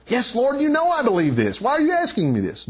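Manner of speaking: high-pitched, exasperated imitation; mock frustration. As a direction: act out an impatient, frustrated reply. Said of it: "it's not revealing frustration".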